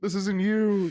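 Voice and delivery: a mock-tragic plea pitched high; melodramatic movie line. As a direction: overplay it like a teary film climax. Echoing a dramatic movie line.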